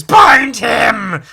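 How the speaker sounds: huge, high theatrical yell; shouted command. Acted. Cult leader ordering them to bind him, full shout. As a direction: roar the order to your followers. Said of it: "And then he says".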